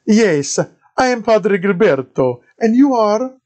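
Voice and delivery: put-on high, loud thick Italian accent of a friar; Italian-accented padre greeting a stranger. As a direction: play the monk in a heavy Italian accent. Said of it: "the thickest Italian accent I'd ever heard".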